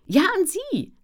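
high, loud, eager insistence; emphatic yes. A narrator's reading. Editor insists Ellen is the right author, eager. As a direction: exclaim it with conviction.

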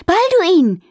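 high, loud call across the garden. A narrator's reading. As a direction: call his name from afar. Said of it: "rief sie".

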